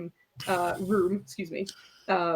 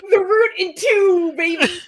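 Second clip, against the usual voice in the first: hammed-up joke; 11 semitones higher, 9 dB louder than usual. Real, not acted. GM jokes loudly, laughing.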